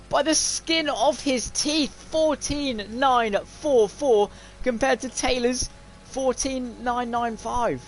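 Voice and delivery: high, slow, drawn-out result call; thrilled race commentary. Real, not acted. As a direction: stretch out each figure, still high from the thrill. Commentator reads out the narrow margin.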